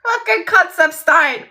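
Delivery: high, loud, cracked-up repeat; laughing disbelief. Real, not acted. Storyteller relishing the nickname, loud and high with laughter. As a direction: blurt it out through laughter.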